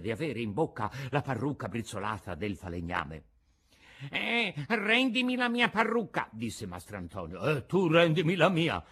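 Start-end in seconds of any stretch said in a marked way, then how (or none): 4.1-6.3 put-on high-pitched indignant old man; Antonio, the carpenter. A narrator's reading. Carpenter demands his wig after the fight, high and indignant. 7.4-8.8 put-on louder, moderately raised old man's retort; Geppetto. A narrator's reading. Old man retorts to the carpenter, loud counter-demand.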